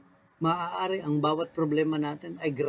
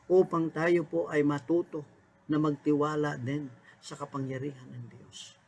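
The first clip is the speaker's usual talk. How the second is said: ordinary talk, nothing marked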